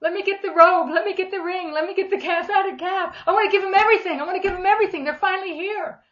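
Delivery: high-pitched, eager tumble of plans; excited father. Acted. Father of the prodigal welcoming his child home, excited. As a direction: act out a father bursting with excitement. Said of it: "He's like all excited".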